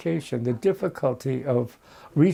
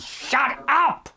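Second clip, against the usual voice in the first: yelled command; 10 semitones higher than usual. Retold or imitated. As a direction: yell it to cut someone off. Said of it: "until the director yelled".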